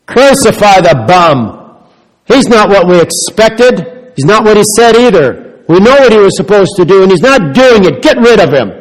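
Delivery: hammed-up angry mob; preacher voicing a furious crowd; crowd crying out. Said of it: "They cried out".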